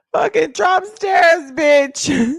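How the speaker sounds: high, loud, slow mock rant; comic outrage. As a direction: cuss it out loudly, half laughing. Comic rant about tariffs.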